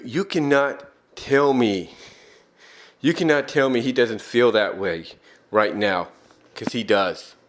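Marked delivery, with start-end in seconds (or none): none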